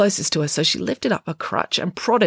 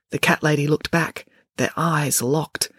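ordinary delivery, nothing marked

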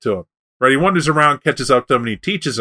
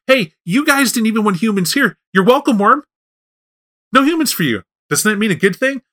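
higher-pitched, lightly acted trickster brush-off; dismissive Coyote; 8 semitones higher than usual. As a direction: talk the worm out of it, pitched up. Coyote brushes off late Worm.

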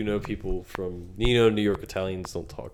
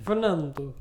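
put-on Italian accent; 7 semitones higher, slower than usual. Saying the name Italian-style.

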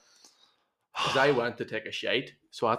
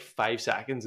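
ordinary talk

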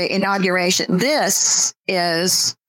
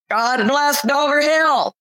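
slow, higher proclamation; commanding; 7 semitones higher, slower than usual. A narrator's reading. Preacher commanding his rally crowd, slow and lifted. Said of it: "Wilder commanded".

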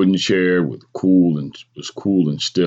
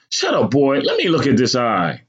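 higher-pitched scolding order; impatient mother; 7 semitones higher than usual.

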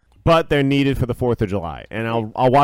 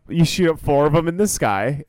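normal talking voice

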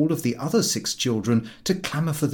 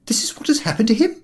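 angry retort; 13 semitones higher, 5 dB louder, faster than usual. Acted. Mother retorts to chief.

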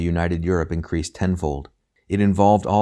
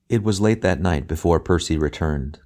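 ordinary delivery, nothing marked

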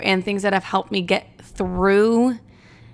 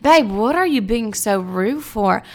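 slow, put-on British accent. Answering the cashier back, slow mock British accent. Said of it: "what's up with the accent".

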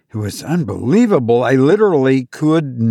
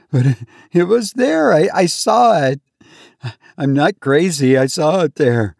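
insistent plea; 4 semitones higher than usual. Acted. Insisting again to attendant.

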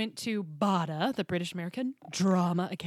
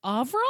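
"Avril" higher, rising into a question; puzzled echo; 5 semitones higher, 6 dB louder than usual. Retold or imitated. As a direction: try "Avril" again, loud and unsure. Retold second puzzled attempt.

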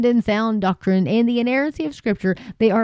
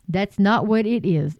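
usual speaking voice

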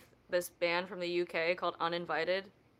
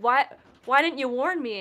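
loud, high mock outrage; playfully dramatic protest; retold dismay; 6 semitones higher, 9 dB louder than usual. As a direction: wail it like a wounded complaint. Retold distressed text to friend.

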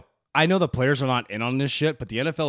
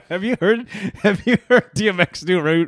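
laughing; 6 semitones higher, 5 dB louder than usual. Real, not acted. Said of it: "laughing while asking".

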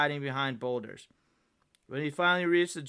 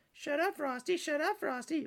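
high-pitched taunting mimicry; childish, singsong mockery; mocking; 13 semitones higher than usual. A narrator's reading. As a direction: parrot it back in a silly high voice. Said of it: "mocked Frosty".

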